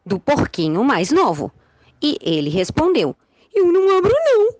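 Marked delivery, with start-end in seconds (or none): 3.5-4.5 high, loud piglet refusal; little pig voice. Acted. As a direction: squeal it out like a scared piglet.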